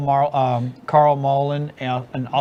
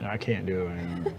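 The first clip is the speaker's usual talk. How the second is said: muffled, much softer through a cup; 7 semitones lower, 13 dB quieter than usual. Real, not acted. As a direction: voice boxed in by a cup. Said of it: "I'm talking to a can".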